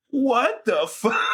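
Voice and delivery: stunned disbelief. Retold or imitated. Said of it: "And I was like".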